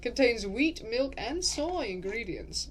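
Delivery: generic English-accented list reading; lilting, loosely English accent; vaguely English narrator accent. Shown as a demonstration. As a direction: recite ingredients in an English-ish narrator voice. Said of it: "I've kind of gotten it back".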